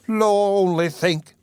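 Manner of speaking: gushing matron caught out; fussing landlady. A narrator's reading. Mrs Mann voiced in story.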